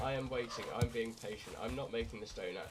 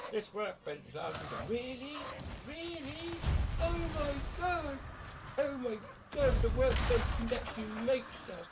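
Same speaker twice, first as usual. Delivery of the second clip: much higher, mocking squeaky bird voice. Mocking the game's helper bird Hugin, squeaky fake amazement. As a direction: ham up the annoying helper bird's amazement. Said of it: "I fucking hate that bird".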